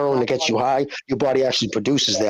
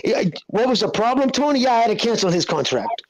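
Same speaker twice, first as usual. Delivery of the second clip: higher, playful mobster quote; gangster boss impression; 8 semitones higher than usual. Retelling a Scarface scene to friends: higher mock mobster voice. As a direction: play the boss casually explaining the hit.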